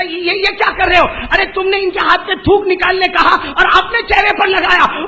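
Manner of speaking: high, loud startled protest; startled grandfather. Acted. Grandfather cries out at the monk in alarm.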